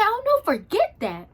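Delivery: high-pitched emphatic refusal in a retelling; animated, high "no way" reaction. Retold or imitated. Retells refusing to follow the crowd.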